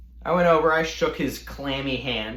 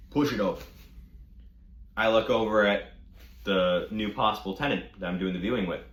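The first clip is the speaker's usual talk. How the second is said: usual speaking voice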